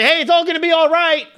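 high, loud, chirpy brush-off; hammed-up breezy reassurance; mock cheery comforter. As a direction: sing it out like a careless optimist.

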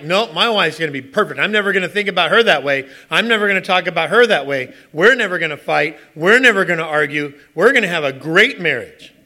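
loud, sure, naive boast; naive confidence. Retold or imitated. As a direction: insist like a naive kid. Naive young self boasting.

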